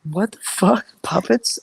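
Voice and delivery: high-pitched retold disbelief; incredulous. Retold reaction to puppet story.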